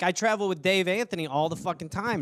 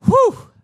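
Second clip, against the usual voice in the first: worked-up exhale; 9 dB louder than usual. Real, not acted. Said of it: "Sorry, I just get fired up".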